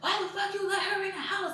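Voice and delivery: high, put-on voice cussing out; mock furious dad; mock angry yell. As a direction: imitate him cussing his kids out. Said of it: "he's like".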